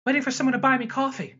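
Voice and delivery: high, quick mock-snide line; snide retort. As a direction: toss it off fast, imitating her snideness. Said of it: "like, snide remarks".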